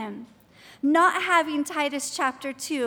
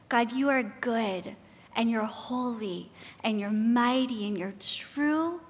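lower, hushed opening prayer; 4 semitones lower than usual. Real, not acted. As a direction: pray earnestly with long pauses between phrases.

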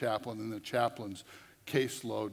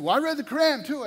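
much higher, louder, fast boast; one-upping boast; 10 dB louder, faster than usual. Retold or imitated. Inmate trying to outdo the preacher, high fast boast. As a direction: top his claim triumphantly.

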